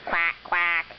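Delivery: playful pretend duck quacks; duck quack imitation. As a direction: quack it out for the toddler. Parent voicing a duck.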